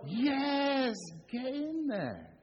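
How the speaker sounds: high, drawn-out celebratory yell; jubilant cheer. Acted. Preacher showing how church should be, jubilant cheer. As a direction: let out a long goal cheer. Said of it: "what we should be is more".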